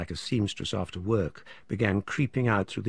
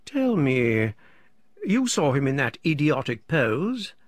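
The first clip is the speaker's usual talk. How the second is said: higher, louder, insistent question; self-important urgency, pitched up; impetuous repeated demand; 4 semitones higher, 4 dB louder than usual. A narrator's reading. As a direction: press the listener for an answer. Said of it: "he repeats".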